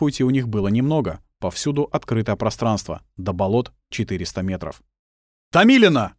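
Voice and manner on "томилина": loud, high shout of a name; yelling. Acted. Gleb yelling to the girl over gunfire. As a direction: bellow her name across the field. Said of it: "проорал Глеб".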